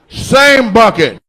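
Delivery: slow punchline. Acted.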